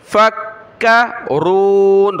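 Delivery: फक्करून with a thick, rounded r; slow फक्करून, full-mouthed rolled r; heavy raa. Shown as a demonstration. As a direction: darken the r of फक्करून and draw out the oon. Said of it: "रा को मोटा किया".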